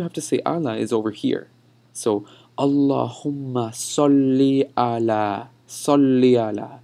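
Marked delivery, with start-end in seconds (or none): 2.6-6.8 salli ala drawn out slowly, the i gliding into ala; connected vowels. Shown as a demonstration. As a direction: link "salli ala" with no break between the vowels. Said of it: "notice how I'm connecting E and E".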